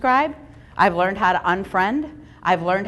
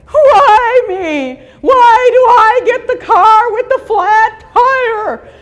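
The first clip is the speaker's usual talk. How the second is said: high, loud, hammed-up whining with a mock sob; self-pitying wail; 12 semitones higher, 12 dB louder, much slower than usual. Stuck with a flat tire, a hammed-up whiny wail. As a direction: moan like the world is against you.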